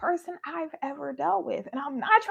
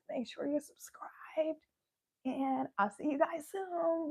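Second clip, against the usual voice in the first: hushed whisper to the viewers; 4 semitones lower, 8 dB quieter than usual. Real, not acted. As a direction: whisper the subscribe line. Repeats the outro.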